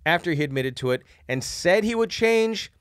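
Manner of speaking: lively letter read; reading a letter aloud. Acted. Reads listener letter aloud.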